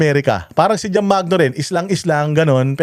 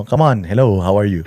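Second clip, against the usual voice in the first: put-on English phone voice; 7 semitones lower than usual. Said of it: "ganun, English-English din ako sa".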